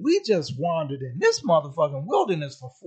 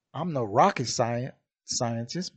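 normal talking voice

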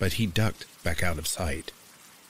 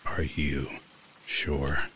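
slow, low, scratchy stranger; creepy stranger drawling the words; 3 semitones lower, much slower than usual. A narrator's reading. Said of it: "his voice low and scratchy".

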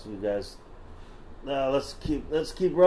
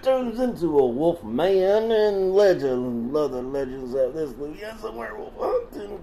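put-on Southern accent; 3 semitones higher than usual. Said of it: "Should I stop doing the voice?".

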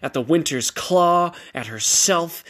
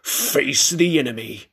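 growled command. A narrator's reading. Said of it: "Darius growled".

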